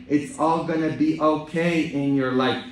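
usual speaking voice

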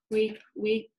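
ordinary delivery

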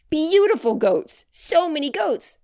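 high, loud, gleeful exclaiming; overjoyed child. A narrator's reading. Grace dances at the goat pen, overjoyed. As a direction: marvel out loud in delight.

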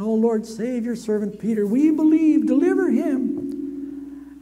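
high, loud fervent praying, slowing on deliver him; elders praying loudly. Acted. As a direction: cry out to God in desperate prayer. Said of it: "They're going".

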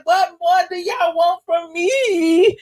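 high, drawn-out tearful plea; hammed-up weepy celebrity impression. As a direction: plead slowly in a mock-crying wail. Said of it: "in my [name] voice".

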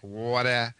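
water said in a New York accent, open drawn-out aw. Shown as a demonstration. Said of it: "when we say water".

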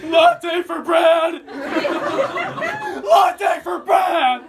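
loud, high, drawn-out mock wail; acted crying. Actor crying in Starbucks, hammed-up sobbing shout. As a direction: wail the order slowly through fake tears. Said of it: "crying in Starbucks".

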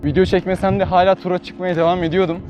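ordinary talk

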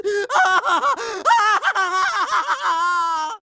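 hammed-up triumphant cackle; long gloating villain laugh; evil villain laugh. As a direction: cackle like a cartoon bad guy. Villain gloats over plan.

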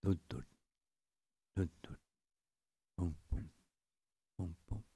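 soft fading mock heartbeat; hushed dun dun boom boom heartbeat; heartbeat imitation. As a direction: thump it out softly, dying away. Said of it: "It's a sound of a heartbeat".

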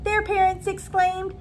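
lilting, sing-song storybook read; reading to children. Acted. Teacher reading a picture book to her new kindergarten class, lilting and gentle. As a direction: read it aloud like a bedtime story for little kids.

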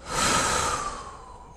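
long, airy exhale; sigh. Real, not acted. Contented sigh after the exercise.

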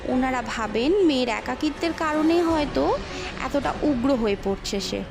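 ordinary delivery

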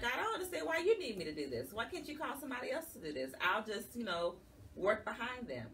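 high, fast, exasperated pleading; pleading with God. Retold or imitated. Frustrated plea to God about leading the group, high and fast.

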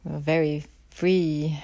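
usual speaking voice

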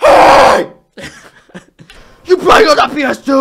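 sudden shouted mock dad yell; mock angry yell. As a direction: bark it like a furious dad. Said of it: "He's gonna be like".

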